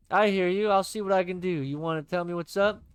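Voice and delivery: loud, bright pal voice; cheery buddy voice. Acted. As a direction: play the upbeat best friend.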